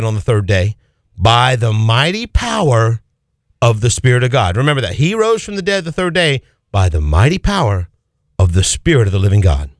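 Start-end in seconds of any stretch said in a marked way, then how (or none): none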